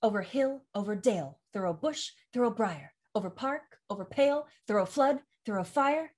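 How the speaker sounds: lilting verse, stress on each third syllable; galloping meter. Shown as a demonstration. Coach demonstrates the meter of Fairy's first four lines, galloping. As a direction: recite it so "hill", "dale", "bush", "briar" land like hoofbeats. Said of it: "listen to the meter".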